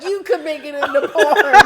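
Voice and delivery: loud, high laughing quote. Retold or imitated. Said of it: "If you were like".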